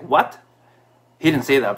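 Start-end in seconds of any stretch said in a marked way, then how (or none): 0.0-0.4 shocked what. Retold or imitated. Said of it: "he was just shocked".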